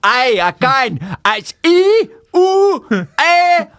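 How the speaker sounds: a put-on Scottish accent, getting angry; thick Scottish accent. Prank caller to restaurant, thick Scottish accent. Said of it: "put on a thick Scottish accent".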